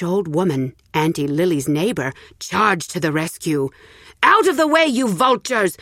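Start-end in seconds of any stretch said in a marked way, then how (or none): 4.2-5.8 high, loud roar at the crowd. Acted. Rich old woman roaring at the villagers, loud. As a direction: bellow to clear the mob. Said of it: "the rich old woman roared".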